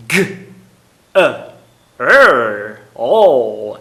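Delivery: G, E, R, O each sound said slowly and apart; slow segment drill of girl; sounds one by one. Shown as a demonstration. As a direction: break girl into its four sounds. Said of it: "fala devagar, bem devagar".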